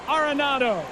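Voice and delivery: normal talking voice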